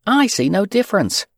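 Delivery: put-on high upset girl's voice; crying little girl. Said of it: "replied Fern".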